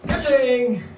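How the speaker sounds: high, loud ka-ching sound effect; cash register ka-ching. Pretend. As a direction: shout ka-ching like a magic trick. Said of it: "I did it again. It's magic".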